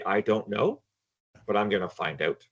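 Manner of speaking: ordinary delivery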